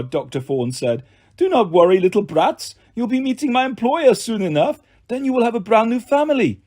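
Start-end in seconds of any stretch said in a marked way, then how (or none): 1.4-6.6 high-pitched, sneering French villain; mock them with a French accent. A narrator's reading. Doctor reassures little brats, mocking French-accented villain.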